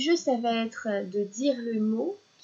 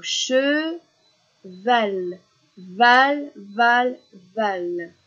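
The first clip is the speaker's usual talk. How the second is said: "cheval" stretched and split, then "val" three times; loud repeats of "val", last one dropping; syllable drill; 6 dB louder, much slower than usual. Shown as a demonstration. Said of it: "je vais le répéter".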